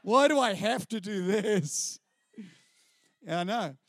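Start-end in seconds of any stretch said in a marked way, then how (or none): 0.0-2.0 put-on slow, whiny child complaint; imitating a fed-up five-year-old, slow whine; bored five-year-old. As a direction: drag it out like a bored kid at church. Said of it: "I'm five years old going".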